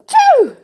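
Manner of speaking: a loud, high blown-out phew of relief. Real, not acted. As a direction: blow it out hard with relief. Relief sigh while crocheting.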